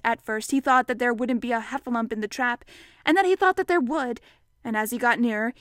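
ordinary delivery

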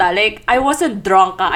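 usual speaking voice

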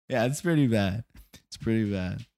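usual speaking voice

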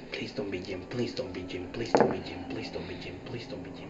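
repeated fearful whispered plea; trembling whisper. Acted. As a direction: chant it softly, half-whispered. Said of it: "Moses saying".

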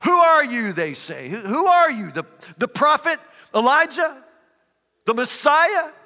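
demanding Pharisee voices, pushing hard; grilling interrogators. Acted. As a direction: hammer him with questions.

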